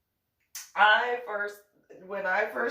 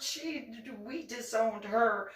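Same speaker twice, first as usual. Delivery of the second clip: ordinary talk, nothing marked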